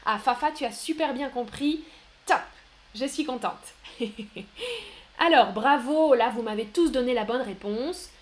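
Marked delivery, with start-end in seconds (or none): 3.8-4.9 high, soft giggle; pleased giggle. Real, not acted. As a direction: giggle lightly with satisfaction. Pleased that a student understood. (petit rire aigu et content)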